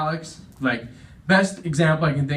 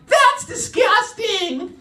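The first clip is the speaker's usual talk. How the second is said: very high, drawn-out falsetto mom; mock shriek of motherly disgust; much slower than usual. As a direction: squeal it slowly in horror. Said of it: "I told my mom that story. She's like".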